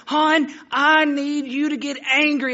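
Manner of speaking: high, slow, drawn-out coach demand; insistent coach. Retold or imitated. Coach shaking the boy to fire him up, exasperated. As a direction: lean on each word like an exasperated coach, imitated. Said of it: "he shakes my hand and says".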